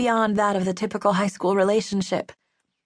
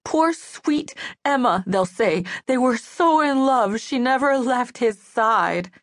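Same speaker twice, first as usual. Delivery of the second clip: higher, drawn-out mock sympathy; 4 semitones higher than usual. A narrator's reading. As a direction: mimic gushing mourners in mockery. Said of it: "Now her tone was mocking".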